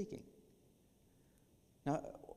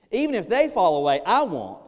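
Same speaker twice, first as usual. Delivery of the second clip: shouted higher, cocky vow; loud boast; 7 semitones higher, 16 dB louder than usual. Acted. Preacher shouting Peter's boastful vow. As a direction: fling the promise out loudly.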